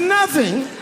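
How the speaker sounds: very high-pitched echo question; mock disbelief. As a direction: feign shock, voice shooting up. Feigned shock at empty box.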